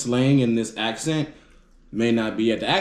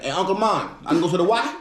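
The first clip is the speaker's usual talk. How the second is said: his younger Southern self; 6 semitones higher, faster than usual. Retold or imitated. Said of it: "So I'm like".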